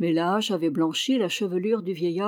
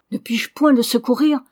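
exalted plea; 4 semitones higher, 6 dB louder than usual. Acted. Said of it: "avec une soudaine exaltation".

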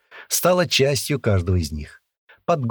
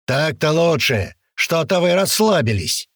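drill sergeant; 7 semitones higher than usual. A narrator's reading. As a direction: chide the platoon sternly. Sergeant scolds the platoon.

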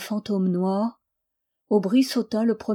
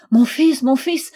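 loud, raised exclamation; urgent calling, pitched up and louder; mother's cry; 5 semitones higher, 7 dB louder than usual. A narrator's reading. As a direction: cry out to a son across the water. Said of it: "s'écria madame Reine".

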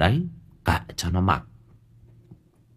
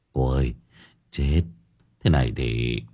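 low, soft worried plea; frightened whisper; 8 semitones lower, 4 dB quieter than usual. A narrator's reading. Parents fearing the neighbours, low soft fearful plea. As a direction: plead in fear.

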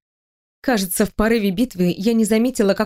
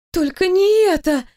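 high-pitched dismayed wail; inner wail; 8 semitones higher than usual. A narrator's reading. Employee dreading the boss's approach, wailing inwardly. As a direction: wail it high, as a panicked thought. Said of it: "взвыла мысленно я".

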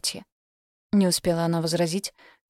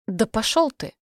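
high, angry shout; 4 semitones higher than usual. Acted. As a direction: yell it as she storms off. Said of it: "крикнула Соня".

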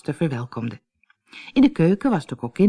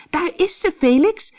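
put-on much higher-pitched girl's cry; excited call; 13 semitones higher, 3 dB louder than usual. A narrator's reading. Anneke at the window spots the famous witch, excited high call. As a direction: call out high to point someone out. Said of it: "riep Anneke".

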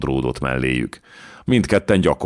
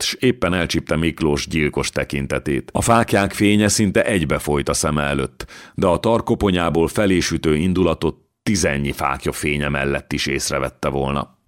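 ordinary delivery

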